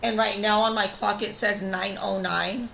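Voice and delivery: usual speaking voice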